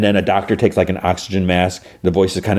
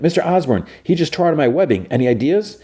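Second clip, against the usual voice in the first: nervous hero; 8 semitones higher than usual. Retold or imitated. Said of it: "And Spider-Man's like".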